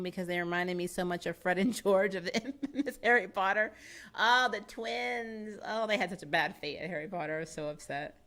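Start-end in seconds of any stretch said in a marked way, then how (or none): none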